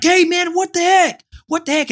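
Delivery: high, loud retold challenge; heated re-enacted shout; confrontational yell. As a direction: act out yelling at a man outside your house. Acted confrontation with a stranger.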